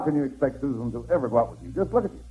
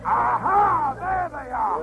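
triumphant shout; 8 semitones higher, much slower than usual. Acted. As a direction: pounce on them, shouting. Husband finds wife in studio.

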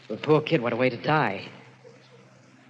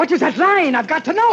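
high, loud, frantic demand; frantic yell at peak pitch; desperate shouting; 13 semitones higher, 8 dB louder than usual. Acted. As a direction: shout it as if possessed by the need to know. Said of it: "please, stop shouting".

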